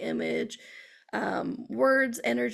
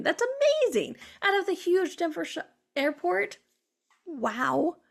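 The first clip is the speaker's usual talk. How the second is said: high, delighted burst of amazement; amazed exclamation; 6 semitones higher than usual. Retold or imitated. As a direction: marvel at it, pitch soaring. Exclaims over airport coincidence.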